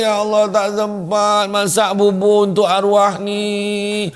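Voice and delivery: high, slow, drawn-out wail; hammed-up lament of a widow; mock weeping. Wife lamenting porridge never cooked for late husband, mock crying. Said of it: "Masa tu pandai lah nangis".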